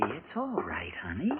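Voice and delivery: whispered comfort, voice dropped to a breath; soft reassuring whisper. Acted. Husband to frightened wife, soft whispered reassurance. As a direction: hush her gently so the old man won't hear.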